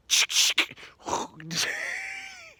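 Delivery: a sudden high cracked laugh that tips toward crying; high laugh-cry burst. Real, not acted. Telling of the broken arm, a high cracking laugh-cry. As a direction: let a pained laugh break high. Breaks down at the iced tea.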